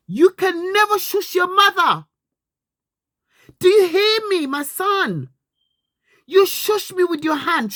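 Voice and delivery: loud, high, furious scolding; angry shouting. Real, not acted. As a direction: yell at him in real outrage. Mother shouting at her son.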